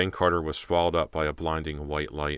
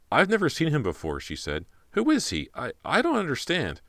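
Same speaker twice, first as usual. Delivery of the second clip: bewildered; 10 semitones higher than usual. Acted. Confused, shocked victim.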